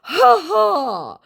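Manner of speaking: high, slow scammer with fake laugh; exaggerated mock-laughing voice. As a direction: play a loud, put-on amused scammer. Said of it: "he goes".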